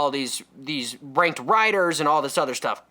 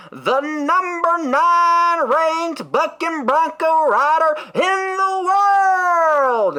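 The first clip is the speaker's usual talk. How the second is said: drawn-out, high booming announcer voice; rodeo announcer; 13 semitones higher, 6 dB louder, much slower than usual. Retold or imitated. Cowboy-hat host hyping the rodeo crowd, slow booming announcer. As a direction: stretch every word like a hype announcer. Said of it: "hype the crowd up".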